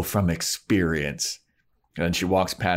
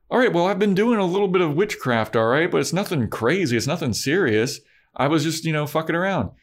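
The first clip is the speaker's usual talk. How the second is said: higher, sheepish confession; sheepish Willow; 8 semitones higher than usual. Retold or imitated. Willow admits witchcraft to Buffy, higher and sheepish. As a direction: admit it but play it down, pitched up.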